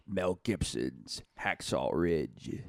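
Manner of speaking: deep, slow movie trailer voice. Acted. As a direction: announce it like an epic film trailer. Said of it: "why'd you do the trailer voice".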